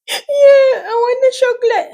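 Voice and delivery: high tearful mock toddler whine; crying toddler. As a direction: whine it like a teary child asking for chocolate. Daughter voiced crying for chocolate.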